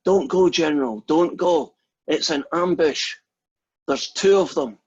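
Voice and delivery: mock-urgent cry of warning, punchline delivery; shouted warning. Staggering survivor warning the general of an ambush, mock shout. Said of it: "he shouts at the general".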